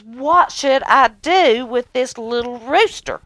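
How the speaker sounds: high, loud outraged king; king fuming at his servants; angry king. Acted. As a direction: demand it like a king beside himself. Infuriated king asks servants.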